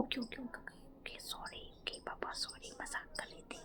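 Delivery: hushed, scared ok-ok-sorry; timid whispered sorry; meek whispered apology. Retold or imitated. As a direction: whisper it, backing down fast. Apologising after an angry glare.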